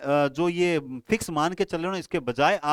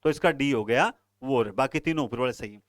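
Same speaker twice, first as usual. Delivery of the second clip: ordinary talk, nothing marked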